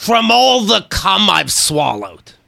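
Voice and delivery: Harvey Fierstein voice. Acted. Punchline to cohosts in a slow Harvey Fierstein voice. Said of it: "that's Harvey Firestein now?".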